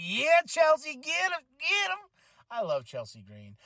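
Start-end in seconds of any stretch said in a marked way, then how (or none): 0.0-2.1 high excited fan cheer; squealing fangirl cheer; cheering her on. Retold or imitated. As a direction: egg her on like a hyped fan. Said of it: "I'm like".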